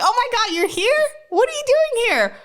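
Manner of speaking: imitating an astonished boyfriend, very high and loud; thrilled disbelief. Boyfriend reacting to her surprise visit in New Orleans, loudly astonished. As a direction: squeal it out, voice shooting way up.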